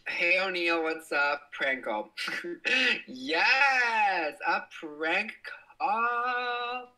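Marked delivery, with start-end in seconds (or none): 2.6-6.9 high, drawn-out gleeful yes; excited. Real, not acted. Streamer thrilled by a prank call request, high and delighted. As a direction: relish the idea of a prank call.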